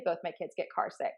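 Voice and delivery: ordinary talk, nothing marked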